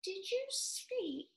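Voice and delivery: high, slow, frosty politeness; frowning but polite mouse. A narrator's reading. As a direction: frown while staying polite. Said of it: "frowning, but very politely".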